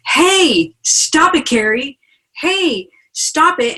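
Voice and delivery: high-pitched, emphatic call to stop; strong warning message. Acted. Angel sending Carrie a strong message, emphatic warning. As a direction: voice an urgent angelic nudge, pitch leaping on each call. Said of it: "send us really strong messages like".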